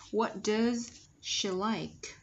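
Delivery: slow, separated "what does she like?"; slow model question. Shown as a demonstration. Teacher about to analyse the sounds, slow careful model.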